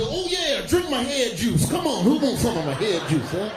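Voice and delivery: hammed-up Kool-Aid man. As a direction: offer your head juice like a party host.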